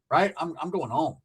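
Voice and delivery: ordinary talk, nothing marked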